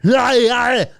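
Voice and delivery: loud, high wordless holler; big hammed-up movie yell; mock Arnold yell. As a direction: imitate an action hero's roar. Mimics Arnold's yell from the film.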